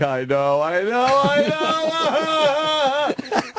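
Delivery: exaggerated blubbering, climbing to a high drawn-out wail; mock crying. Caught cheater crying to his wife on the phone, hammed-up blubbering. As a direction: imitate a man breaking into a long, high sob. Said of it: "he just started crying on the phone".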